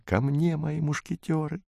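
put-on thrilled girl calling softly; quiet cry. A narrator's reading. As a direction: shout it under your breath. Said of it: "даже тихонько закричала".

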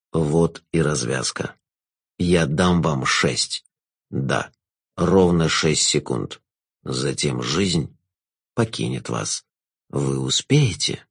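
low, slow, velvety jester; sinister jester. Acted.

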